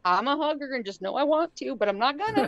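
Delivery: lightly voiced breathless gush; eager pleading. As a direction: beg for a hug you won't take. Hugger begging Seattle nurse.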